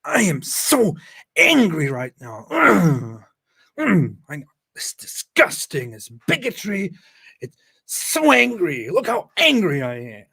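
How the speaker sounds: hammed-up fake fury, high and loud; hammy mock outrage with a choked cry; mock angry rant. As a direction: overact being furious, mocking him. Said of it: "the anger expressed is not even genuine".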